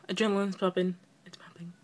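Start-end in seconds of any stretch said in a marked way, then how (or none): 1.3-1.7 very soft whisper to oneself; hushed, barely audible whisper; whispered aside. Real, not acted. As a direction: whisper it under your breath. Calming herself down, whispered.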